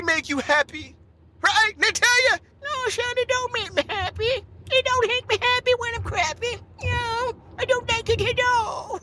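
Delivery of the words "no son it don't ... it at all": put-on high, wavering old-timer voice, sorry for himself; a put-upon grandpa whining about diapers; old grandparent voice. Grandpa complains to grandson about diapers, high whiny old voice.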